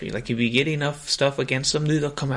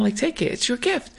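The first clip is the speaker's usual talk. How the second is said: high, insistent mock hotel lady pushing a gift; pushy lady voice; 11 semitones higher than usual. Hotel lady urging the reporter to take it, high mock insistence. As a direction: push the gift on him in a higher put-on voice.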